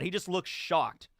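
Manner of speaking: ordinary delivery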